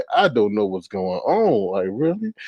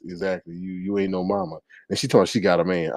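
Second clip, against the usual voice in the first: normal talking voice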